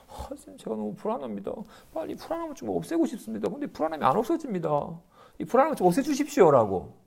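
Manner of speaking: high, hushed, hurried plea for help; anxious pleading. Retold or imitated. A disciple begging his teacher to remove anxiety, high and pleading. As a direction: beg the teacher to take the worry away.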